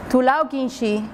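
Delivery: usual speaking voice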